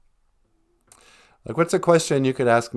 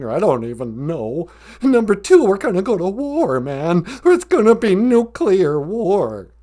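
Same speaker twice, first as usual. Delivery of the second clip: agitated lion listing his fears, building louder; scared Cowardly Lion; 8 semitones higher, 4 dB louder than usual. Acted. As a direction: work yourself up into a higher-pitched fret. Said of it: "Why are you so scared?".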